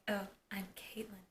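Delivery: very soft, under the breath; hushed shy introduction. Retold or imitated. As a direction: imitate a timid hello, barely voiced.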